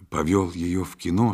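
usual speaking voice

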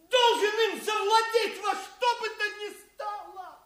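shouted vow. Acted. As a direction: cry out the vow. Villain vows to get it. (выкрикнутая клятва)

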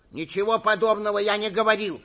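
high, loud protest; exclaiming denial. A narrator's reading. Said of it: "воскликнул Суханок". (громкое возражение на высоких нотах)

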